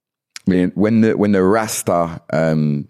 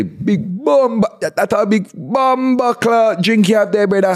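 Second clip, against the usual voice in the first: high, stretched exclamation over a drink; mock amazed exclamation; 13 semitones higher than usual. Shown as a demonstration. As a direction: marvel high, dragging out the key word. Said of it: "or you could say".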